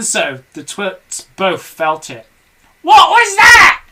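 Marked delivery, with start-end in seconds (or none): 2.8-3.8 very high, loud witch screech; Mrs Twit crying out. A narrator's reading. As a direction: shriek it like a shrill old hag. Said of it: "cried Missus Twit".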